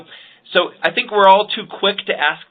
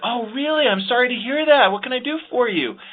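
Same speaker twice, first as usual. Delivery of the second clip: upbeat, enthusiastic; 7 semitones higher than usual. Retold or imitated. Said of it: "she said it in the right tone".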